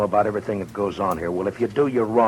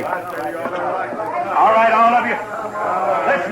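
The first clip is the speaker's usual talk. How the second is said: calling out; 10 semitones higher, much slower than usual. Acted. Marshal addressing crowd.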